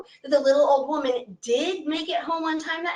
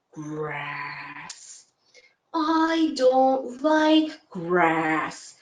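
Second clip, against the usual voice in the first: very slow, low, lazy cow drawl; slow deep cow voice; 6 semitones lower, much slower than usual. Acted. Cow answering the old lady, slow and low. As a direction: speak like a sleepy cow chewing cud. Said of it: "in her slow, deep cow voice".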